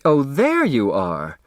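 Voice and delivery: a lightly voiced mother, slow and a touch reproachful; mother's greeting. Grace greeting Turtle as if she were tardy, slow mild reproach. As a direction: greet her as if she were the late one.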